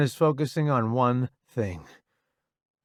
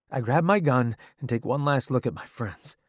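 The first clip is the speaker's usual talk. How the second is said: ordinary delivery, nothing marked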